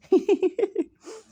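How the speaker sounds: high, loud burst of laughter; real laugh. Real, not acted. Laughs at his own joke.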